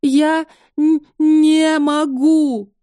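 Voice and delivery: stutter, then drag it out sobbing; crying on the phone. Acted. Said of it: "Я заплакала в трубку".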